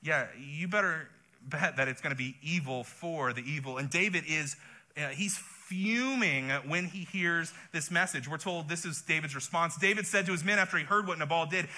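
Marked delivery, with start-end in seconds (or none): none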